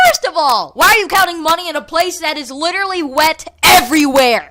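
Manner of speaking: loud, high indignant rant; outraged rant. Retold or imitated. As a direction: blast him with disbelief. Ranting at the money-counting kid.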